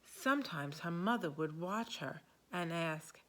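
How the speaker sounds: ordinary delivery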